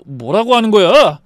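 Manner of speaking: rising to a loud outburst; flustered protest. A narrator's reading. Husband protesting at the mother's accusation, flustered. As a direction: object in rattled disbelief.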